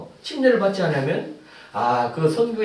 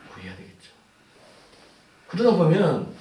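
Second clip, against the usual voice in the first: normal talking voice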